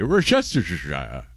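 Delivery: WORCESTERSHIRE with every syllable, British style; put-on posh British Worcestershire; fancy British accent. Shown as a demonstration. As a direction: say Worcestershire grandly, ending in "shy-ah". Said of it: "Say it in a fancy or otherwise British".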